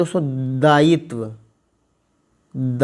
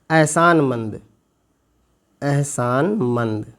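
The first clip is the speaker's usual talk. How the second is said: slow repeated word; much slower than usual. Shown as a demonstration. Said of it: "इसका अर्थ होता है".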